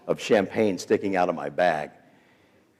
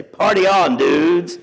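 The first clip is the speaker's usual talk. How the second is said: lightly voiced partying dude, pitch a bit raised; mock party-kid cheer; 7 semitones higher, 5 dB louder, slower than usual. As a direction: call it out like a carefree teenager. Voices partying kids as a joke.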